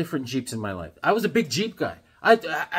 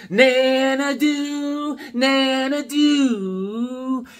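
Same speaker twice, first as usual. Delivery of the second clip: high, very slow, stretched "Nanadu"; sing-song name; 10 semitones higher, 4 dB louder, much slower than usual. Retold or imitated. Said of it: "I was like".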